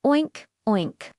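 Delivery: piggy oink voice. Pretend. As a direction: snort it like a little pig. Piglet sound for kids.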